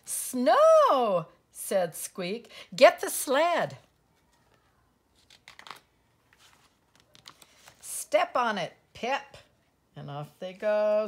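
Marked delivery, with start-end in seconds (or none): 0.0-1.3 loud, high cry of discovery; excited shout. Acted. Mouse spots snow on the way out, excited shout. 2.8-9.4 loud, high-pitched orders; urgent command. Acted. Squeak hurrying Pip onto the sled, urgent command.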